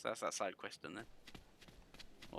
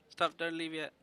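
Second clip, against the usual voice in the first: raised pleading call; 7 semitones higher, 6 dB louder than usual. Acted. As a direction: call after them, louder. Calling after leaving friend.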